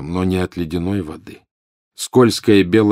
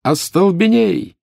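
shouted spells; 11 semitones higher, 4 dB louder, slower than usual. Acted. Frantic spell casting in battle.

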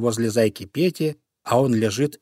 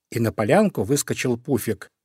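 ordinary delivery, nothing marked

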